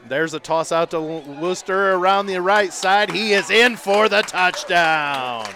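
loud touchdown call, stretched out at the score; excited play-by-play. Real, not acted.

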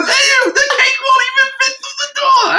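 high, hammed-up Long Island shout; mock cake boss. Cake boss can't fit cake through door, mock yell.

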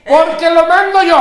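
slow, loud and high, mocking authority; booming book title. Shown as a demonstration. Father mocking the title of a parenting book, booming. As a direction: announce it like a bossy decree. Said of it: "el pinche libro decía".

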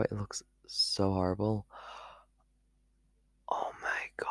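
hushed whisper of dismay. Real, not acted. To herself, horrified at her eyebrow, whispering. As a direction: breathe it out, horrified at the mirror.

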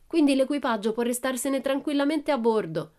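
high-pitched calling out to the crew; shout. A narrator's reading. Pippi tells the crew to stay aboard, calling out. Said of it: "gridò".

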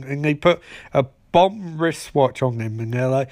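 ordinary delivery

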